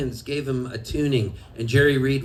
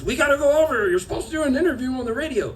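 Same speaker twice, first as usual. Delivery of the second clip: hurried Sharon; 8 semitones higher, faster than usual. Retold or imitated. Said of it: "Sharon grabbed me and goes".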